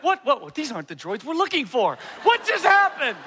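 high, baffled what just happened; mock bewildered. Parent realizing daughter talked her way out, mock bewildered. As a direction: play the dazed parent who got outwitted.

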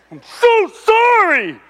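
high, slow, hammed-up sorry; exaggerated apology. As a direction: stretch it out like an overdone apology. Retold apology to his son.

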